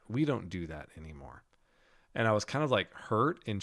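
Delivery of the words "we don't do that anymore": a hint of a sneering dead pastor, low and soft; low, quiet sneer. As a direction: say it down to him with quiet contempt, lower. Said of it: "Like, just like that".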